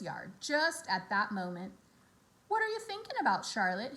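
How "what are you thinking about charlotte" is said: high, curious piglet voice; Wilbur asking. A narrator's reading. Wilbur asks Charlotte what she is thinking, higher. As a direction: ask innocently. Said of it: "he asked".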